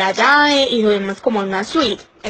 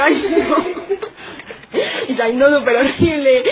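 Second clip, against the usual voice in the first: high, laughing whimper; mortified teller laughs through her embarrassment; embarrassed laugh-cry; 4 semitones higher than usual. Real, not acted. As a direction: laugh and cringe at once.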